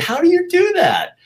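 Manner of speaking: way up high in amazed disbelief; astonished. Retold or imitated. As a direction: squeak it out in total wonder. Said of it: "And I said".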